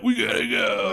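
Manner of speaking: urgent hammed-up movie line; action hero urgency. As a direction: bark it like a movie tough guy. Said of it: "Dom's like".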